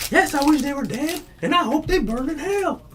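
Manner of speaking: high, hammed-up put-on voice; high hammed-up voice cursing them to hell; high mock character voice. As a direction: squeak out a mock curse. Quoting a comedy character.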